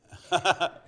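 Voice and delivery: laughing. Real, not acted. Preacher laughs at congregant.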